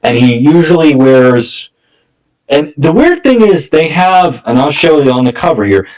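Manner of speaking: ordinary talk, nothing marked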